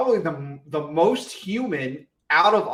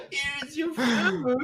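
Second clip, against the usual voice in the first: mock grandma; 9 semitones higher, 4 dB quieter, much slower than usual. Teasing grandma voice.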